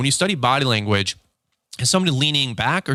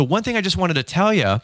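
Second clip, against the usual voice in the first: higher-pitched, excitable lead-in; raised, excited voice; 7 semitones higher than usual. Shown as a demonstration. Salesman adding one thing for the customer, excited. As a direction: lift the pitch to sound excited. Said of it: "you're raising your voice".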